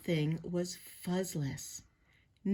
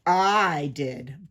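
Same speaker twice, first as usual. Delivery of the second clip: low, loud villain's admission; booming, lowered voice; barked; 5 semitones lower, 5 dB louder than usual. A narrator's reading. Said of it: "barked a voice from above".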